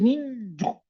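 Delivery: "niño" with the nose blocked, ñ failing to come out; pinched-nose niño. Shown as a demonstration. Teacher showing blocked air, stopped-up "niño". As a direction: say "niño" holding your nose shut. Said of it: "la ñ de niño con la nariz tapada".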